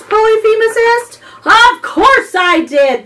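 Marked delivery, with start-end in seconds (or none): 1.4-3.0 loud roar; booming giant roar. A narrator's reading. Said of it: "Belbum roared".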